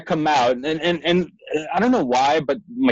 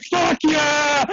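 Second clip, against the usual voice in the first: triumphant whoop; 12 semitones higher than usual. Retold or imitated. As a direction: crow over the win. Celebrating win while others worry.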